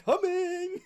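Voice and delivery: very high, loud sing-song call; creepy eager call. Retold or imitated. Character dashing at the player calls out very high and loud. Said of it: "but he's saying".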